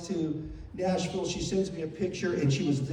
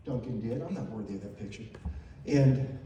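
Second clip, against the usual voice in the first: ordinary talk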